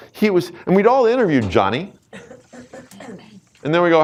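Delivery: usual speaking voice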